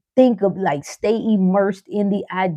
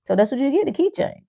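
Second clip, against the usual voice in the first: usual speaking voice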